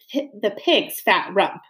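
ordinary delivery

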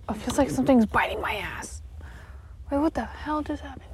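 hushed whisper. Real, not acted. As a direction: whisper it under your breath. Whispered aside in dark room.

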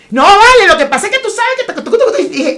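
high, loud Venezuelan street vendor voice; the vendor chatting them up, imitated high and loud; imitating the hot dog vendor. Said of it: "Y él".

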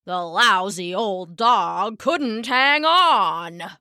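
put-on slow, sulky old man's grumble; lightly voiced grumpy old man; grumbling Papa. A narrator's reading. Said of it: "muttered Papa".

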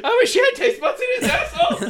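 high, loud laughing yell; laughing shout. Pretend. Joking shout about friend.

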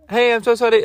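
high-pitched mock apology; apologetic driver. Driver apology acted before angry reply.

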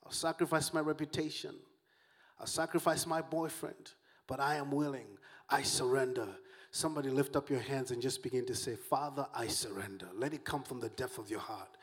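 low, subdued prayerful voice; hushed surrender prayer. Real, not acted. Preacher leads the congregation to pray "I surrender", hushed and prayerful. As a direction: bring it right down into humble surrender.

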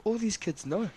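high, amazed realisation; amazed aside. Retold or imitated. Vlogger realising every kid knows, high and amazed. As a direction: say it like it just dawned on you. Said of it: "I was like".

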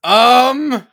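high, blaring "ummm"; alarmed, stretched-out um; loud, drawn-out "um". Acted. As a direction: hold a loud um as if the head is buzzing. Character's head is humming and vibrating.

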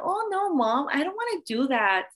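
put-on high-pitched child's American twang. Godchild protesting to Mom in English, imitated American twang. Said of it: "Talagang may twang".

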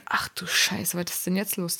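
leise geflüstert vor Schreck; flüstere es erschrocken vor dich hin; flüsternd. Retold or imitated. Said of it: "erschrockener Gedanke, geflüstert". (erschrockenes Flüstern)

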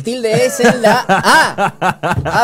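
slow, high and loud through laughter; laughing. Real, not acted. Cracking up while correcting the host, slow laughing shout.

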